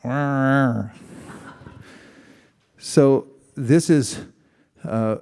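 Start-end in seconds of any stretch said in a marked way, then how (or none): 0.0-0.9 grunt. Shown as a demonstration. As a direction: grunt like a caveman. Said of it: "Another question".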